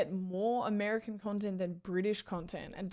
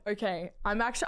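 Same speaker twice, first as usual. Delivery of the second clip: usual speaking voice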